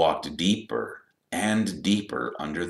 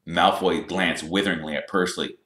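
ordinary delivery

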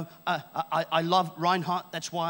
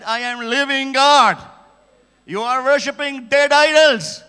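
slow, booming declaration, very loud and raised in pitch; thundering divine rebuke; 7 semitones higher, 15 dB louder than usual. Retold or imitated. God rebuking a man worshiping idols, thundering retold shout. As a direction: proclaim it like God confronting a man.

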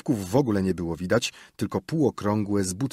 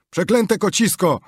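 loud, high furious yell at a cat; angry shout; 11 semitones higher, 8 dB louder than usual. Acted. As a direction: bellow the curse out the window. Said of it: "Gniewny okrzyk".

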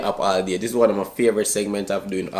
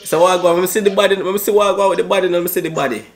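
put-on Jamaican accent; 7 semitones higher, 3 dB louder, much faster than usual. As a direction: fake Jamaican accent, high and loud. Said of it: "my Jamaican accent".

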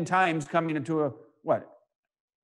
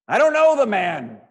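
loud, higher-pitched swearing denial; 4 semitones higher, 6 dB louder than usual. Acted. Preacher acts Peter denying he knows Jesus, loud swearing denial. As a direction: deny it forcefully, as if swearing.

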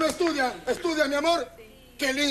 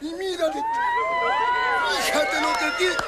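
mock outrage; 4 semitones higher than usual. Comic shows the audience what is in the bag, mock outrage. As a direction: exclaim as if utterly scandalised.

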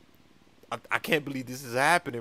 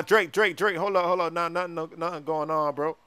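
ordinary talk

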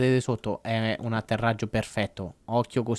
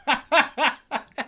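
high laugh; 12 semitones higher than usual. Real, not acted. Laugh after success.

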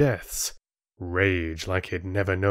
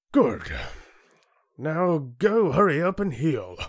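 dismissive chieftain; 8 semitones higher than usual. Acted. Chieftain dismisses boy indifferently.

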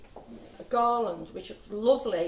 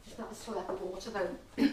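dry mouth; 7 dB quieter than usual. Real, not acted. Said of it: "My tongue stuck to the roof of my mouth".

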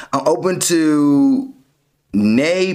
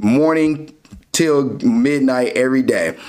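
usual speaking voice

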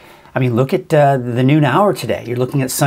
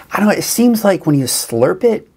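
usual speaking voice

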